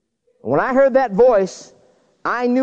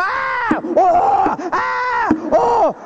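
high mock screams of falling skiers; imitated screams; much slower than usual. Skiers crashing around him, high mock screams. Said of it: "these people around me go".

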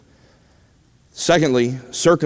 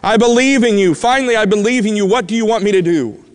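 high, louder, urgent replies; acted desperate eagerness; 7 semitones higher, 3 dB louder than usual. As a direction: blurt it like a man clinging on for life. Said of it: "in a fit of desperation".

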